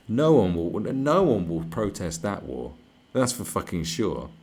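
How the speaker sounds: loud, emphatic rant. Real, not acted. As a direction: proclaim it forcefully.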